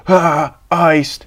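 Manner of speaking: mock yell. Shown as a demonstration. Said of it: "and you yell".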